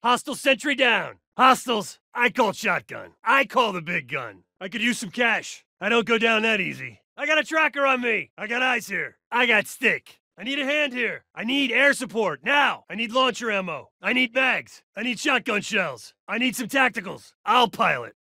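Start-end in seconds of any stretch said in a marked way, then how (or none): none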